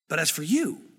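"But as for you" pitched low, swinging up on "you"; emphatic stress. Shown as a demonstration. Pastor repeating the emphatic verse, "But as for you" low with weight on "you". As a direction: lean hard on the final "you" in "But as for you". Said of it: "all of a sudden gets louder and bigger".

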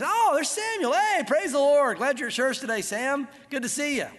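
over-sweet mock pious welcome; fake Christianese. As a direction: gush like a fake churchgoer. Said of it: "He starts speaking Christianese".

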